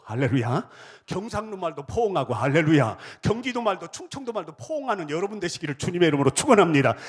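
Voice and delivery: loud, rising revival blessing; thundering benediction over the music; preaching at full force. Acted. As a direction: build the blessing to a roar.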